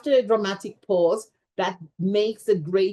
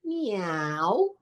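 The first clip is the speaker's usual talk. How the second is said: cat meow. Retold or imitated. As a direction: mew like a cat. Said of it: "she would be going".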